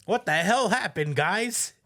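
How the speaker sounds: loud, high-pitched exasperated outburst; hammed-up yell of disbelief; mock boss yelling. Boss yelling at her bum-ass employees, hammed-up exasperated shout. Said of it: "yelling at hi-, her bum-ass employees".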